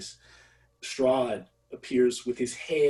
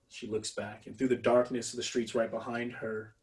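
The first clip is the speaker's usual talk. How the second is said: ordinary delivery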